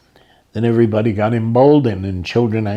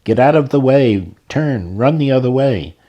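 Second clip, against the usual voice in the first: loud, higher warning cry; urgent crowd yelling get out of the way; shouting warning; 4 semitones higher, 4 dB louder than usual. Retold or imitated. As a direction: yell at him to run.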